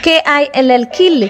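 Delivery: quick classroom spelling, letter by letter; fast teacher drill of spelled words; brisk spelling drill. Shown as a demonstration. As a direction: rattle off letters then the word, fast. Spells out word list quickly.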